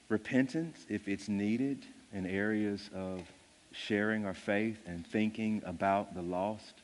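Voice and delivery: closing prayer, hushed and earnest. Acted.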